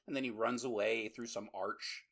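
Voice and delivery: normal talking voice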